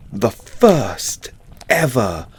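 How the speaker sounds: normal talking voice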